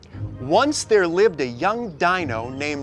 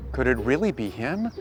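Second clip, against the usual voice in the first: ordinary talk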